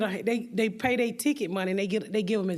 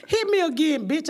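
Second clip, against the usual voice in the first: loud, raised-pitch threat; angry dare; 8 semitones higher, 5 dB louder than usual. Retold or imitated. Comic to heckler in crowd, angry retold dare. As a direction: act out daring someone to try it.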